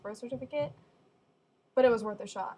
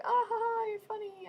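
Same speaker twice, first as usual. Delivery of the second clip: mom's laugh; 11 semitones higher than usual. Retold or imitated. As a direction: fake a polite laugh as mom. Mom laughing at joke.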